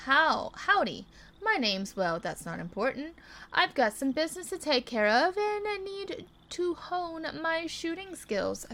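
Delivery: lightly voiced cowboy with a southern twang; hint of a southern cowboy accent; southern drawl. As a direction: read it like a frontier gunslinger. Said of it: "my little southern accent".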